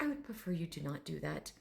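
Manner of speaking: soft, restrained refusal, lightly voiced; proper gentleman. Dragon refusing a whole bedroom, softly proper. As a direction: decline quietly and formally. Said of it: "this very proper gentleman".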